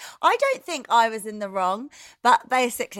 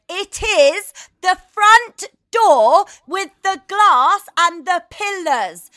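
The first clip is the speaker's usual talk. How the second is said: higher, louder and slow, word by word; shouting the same directions at the confused driver; exasperated shout; 8 semitones higher, 12 dB louder, slower than usual. Retold or imitated. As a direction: bellow it out slowly in frustration. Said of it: "It ends in me going".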